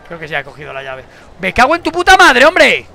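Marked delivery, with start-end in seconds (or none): 1.4-2.9 high, loud furious swearing; furious outburst. Real, not acted. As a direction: explode at the screen. Sudden rage at the game. (estallido de rabia, agudo y fuerte)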